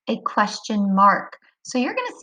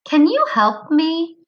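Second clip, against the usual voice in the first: "Can you help me?" higher and louder, voice going up on "me"; question intonation; 7 semitones higher, 5 dB louder than usual. Shown as a demonstration. Teacher showing children how a question sounds, question intonation. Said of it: "hear your voice kinda go up at the end".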